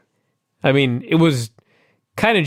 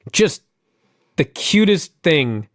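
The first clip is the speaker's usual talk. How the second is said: ordinary talk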